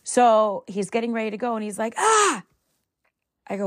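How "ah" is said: high, loud sudden yelp of panic; panicked yelp. Retold or imitated. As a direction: yelp like you just remembered something. Son panics about shoes.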